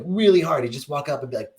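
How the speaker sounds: normal talking voice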